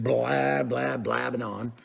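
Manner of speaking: low, trailing string of blahs; droning blabber. Retold or imitated. Fishing host mocking his own rambling, droning blabber. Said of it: "just throwing all this stuff out there".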